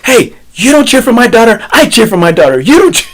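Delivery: high, loud playful telling-off; mock protest. Dad telling his friend who cheers for his daughter, mock protest. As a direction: squawk it in jealous mock outrage.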